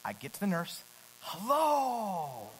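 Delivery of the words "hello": high, slow, sing-song overly cheerful greeting; Mister Cheerful. Retold or imitated. Patient greeting the nurse, hammed-up cheerful hello. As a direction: greet her with forced sunny brightness. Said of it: "I'm gonna be Mister Cheerful".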